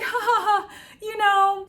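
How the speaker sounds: high, strained fake laughter; forced laugh. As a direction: laugh it off while close to tears. Forced laugh hiding tears.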